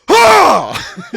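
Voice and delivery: loud high yelp; dad's yelp. Retold or imitated. Son imitating his dad's pain yell, loud high yelp. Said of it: "my dad would go".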